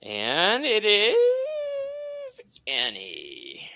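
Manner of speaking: slow, high sing-song reveal; dramatic reveal. Acted. Announcing the drawn card to viewers, slow high reveal. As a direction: stretch the name out high.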